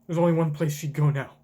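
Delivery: ordinary talk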